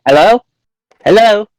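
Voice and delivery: high, loud questioning phone greeting; put-on prank voice. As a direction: shout a high-pitched silly hello. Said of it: "doing voices".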